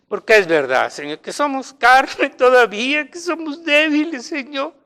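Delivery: plegaria que sube en tono y fuerza; oración ferviente. Real, not acted. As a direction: ruega a Dios con fervor, alzando la voz. Dirige una oración de perdón. (oración de súplica ferviente)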